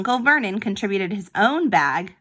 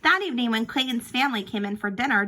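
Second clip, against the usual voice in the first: ordinary delivery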